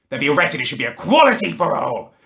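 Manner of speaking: normal talking voice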